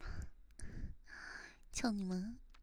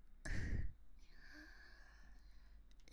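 soft giggle; 5 semitones higher, 5 dB quieter than usual. Real, not acted. Giggles before cursing.

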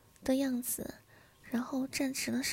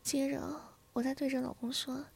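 ordinary delivery, nothing marked

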